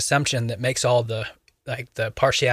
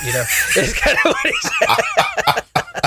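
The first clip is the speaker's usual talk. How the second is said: high, slow words through laughter; laughing; 4 dB louder, slower than usual. Real, not acted. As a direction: squeeze it out laughing. Laughing over the story.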